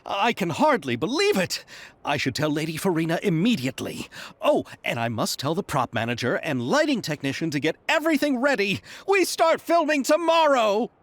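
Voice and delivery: thrilled, high-pitched gushing; overexcited. Acted. Producer overjoyed that filming starts tomorrow, excited. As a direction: gush with delight, pitch leaping at both ends. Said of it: "He's so excited".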